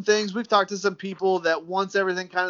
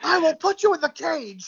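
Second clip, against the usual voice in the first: high, hammed-up threatening voice; mock villain parent; 10 semitones higher than usual. Host jokes threatening toddler, mock sinister. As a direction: threaten the kid like a cartoon villain.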